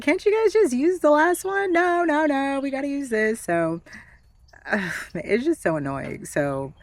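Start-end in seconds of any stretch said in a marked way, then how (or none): 0.0-1.7 high, loud exasperated whine; whiny mock complaint. Patient whining to doctors about repeat tests, high exasperated mock whine. 1.7-3.4 mock doctor refusal. Doctors insisting on another hearing test, high mocking sing-song.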